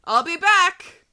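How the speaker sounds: slow, loud, high declaration; a movie hero's parting line; dramatic declaration. Acted. Reading dramatic story line.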